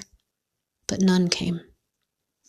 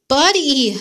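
higher, louder call for the dog; 6 semitones higher, 4 dB louder than usual. A narrator's reading. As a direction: call the lost dog's name. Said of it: "I called, my voice small and gruff".

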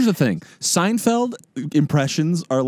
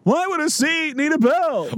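high Seinfeld-style question; 13 semitones higher than usual. Acted. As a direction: wonder aloud like Jerry. Answering Seinfeld impression.

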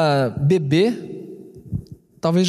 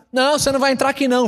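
fast retold refusal; 8 semitones higher, much faster than usual. Stranger refuses in retold fight.

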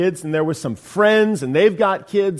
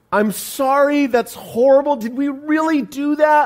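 half laughing; 8 semitones higher than usual. Retold or imitated. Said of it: "half laughing".

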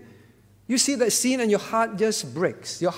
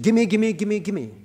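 mock greedy demand. Mocking a greedy spirit in prayer.

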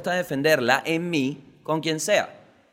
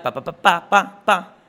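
pasos imitados; 3 semitones higher, 7 dB louder, slower than usual. Retold or imitated. Pasos de un gordo caminando.

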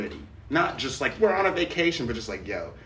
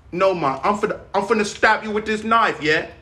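put-on UK accent; 7 semitones higher, 4 dB louder than usual. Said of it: "they're like".

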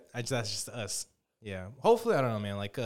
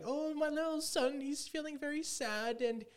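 worried mother; 13 semitones higher, 5 dB quieter than usual. Retold or imitated. Retelling a cartoon, the mother calls in about her sad son, very high and soft. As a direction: plead softly like a fretful mom. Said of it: "his mom called it in".

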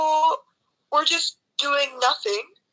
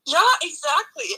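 normal talking voice

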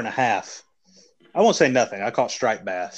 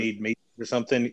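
usual speaking voice

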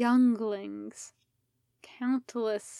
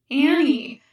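shouted name; 4 semitones higher, 10 dB louder than usual. Acted. Crying out a name.

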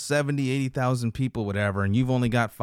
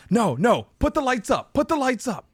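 high-pitched retold yelling, louder on the no's; alarmed husband; 12 semitones higher, 4 dB louder than usual. Husband runs in on his wife, high alarmed shout. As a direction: mimic a frantic husband calling out in a raised, high voice.

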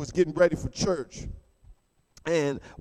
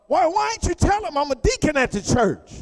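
higher, incredulous husband; indignant husband; 8 semitones higher than usual. Retold or imitated. Husband confronting his wife after church, indignant. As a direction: ask it outraged.

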